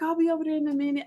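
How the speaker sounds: high, quick mimicked friend; giggly friend. Driver mimicking her friend brushing off the plea for help, carefree. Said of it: "she's still laughing and giggling".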